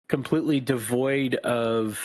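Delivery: usual speaking voice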